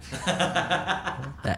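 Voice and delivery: real soft laugh. Real, not acted. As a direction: chuckle at your own story. Laughs after hospital punchline.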